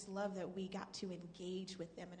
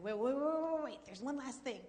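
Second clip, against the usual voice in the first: urgent wait; 6 semitones higher, 8 dB louder than usual. Retold or imitated. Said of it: "they said".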